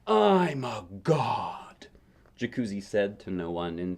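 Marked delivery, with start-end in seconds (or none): 0.1-1.9 slow, loud, drawn-out declaration; grand proclamation. A narrator's reading. Boy alone in the living room, slow grand proclamation. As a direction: announce it like a god on a mountain.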